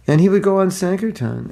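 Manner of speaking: usual speaking voice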